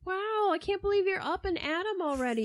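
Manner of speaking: high, falsely chipper sarcastic praise; mock cheerful. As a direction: sneer through fake enthusiasm. Castro mock-praising new mother.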